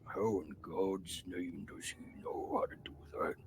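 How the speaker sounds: awed whisper to himself. Acted. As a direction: whisper it in disbelief. Orc's whispered inner thought.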